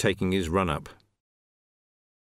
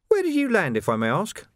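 higher, sly courtesy; mock-polite villain; 10 semitones higher than usual. Carcer asking Vimes where he landed, sly mock courtesy. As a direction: feign politeness.

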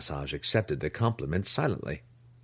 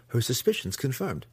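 usual speaking voice

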